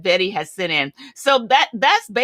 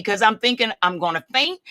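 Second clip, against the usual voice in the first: usual speaking voice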